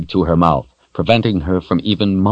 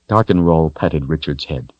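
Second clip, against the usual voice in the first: ordinary delivery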